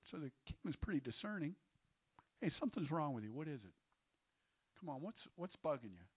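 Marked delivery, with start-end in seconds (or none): 2.4-6.1 softly voiced, casual concern; quiet probing. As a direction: coax it out of them in a low, soft voice. Acts out discerning king probing gently.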